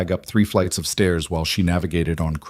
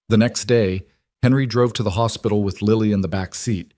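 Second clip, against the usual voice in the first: ordinary delivery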